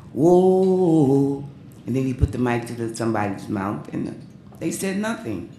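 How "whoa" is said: singer's whoa. Retold or imitated. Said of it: "He'd say".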